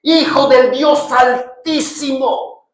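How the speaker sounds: demon's voice. Acted. The demon crying out to Jesus, high and anguished. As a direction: plead high and hard like the possessed man.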